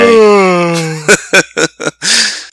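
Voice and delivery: big drawn-out yawning sigh, high; faking tiredness. Pretend. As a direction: stretch out a put-on yawn. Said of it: "after a fake yawn".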